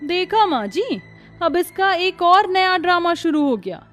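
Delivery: high-pitched scornful jeering; mocking taunt. Sister-in-law mocking Kanchan's new worship, scornful.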